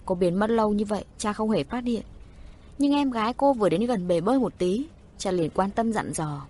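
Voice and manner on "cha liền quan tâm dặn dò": plain reading voice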